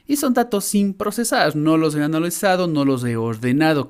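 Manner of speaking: ordinary talk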